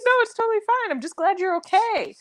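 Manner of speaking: lifted high, sweetly reassuring imitation; high mock-sweet boss voice. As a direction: play the boss, high and sugary, brushing it off. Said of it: "She's like".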